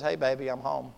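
low, soft words to a wife; tender homecoming greeting. Acted. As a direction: greet your wife gently. Healed husband greets his wife.

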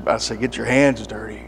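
ordinary talk, nothing marked

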